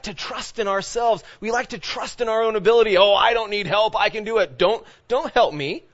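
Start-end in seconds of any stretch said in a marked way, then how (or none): 3.0-5.8 raised, louder show of self-reliance; mock stubborn refusal. Preacher acting out trusting in our own ability, mock stubborn. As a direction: play someone proudly waving off help, pitched up. Voicing proud refusal of help.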